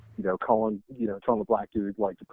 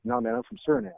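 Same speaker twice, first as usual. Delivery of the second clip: coworker quoted high; 10 semitones higher than usual. Retold or imitated. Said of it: "He was like".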